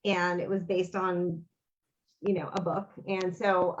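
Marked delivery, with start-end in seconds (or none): none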